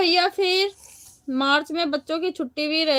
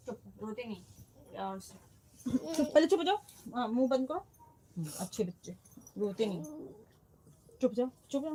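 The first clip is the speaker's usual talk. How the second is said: hushing; 2 semitones lower, 9 dB quieter, faster than usual. Real, not acted. Mother softly hushing her crying little girl. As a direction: gently shush it quiet.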